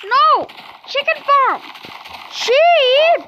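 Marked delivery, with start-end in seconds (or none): 2.3-3.2 higher, louder call to the sheep. Real, not acted. Player calling the sheep to come, high raised call.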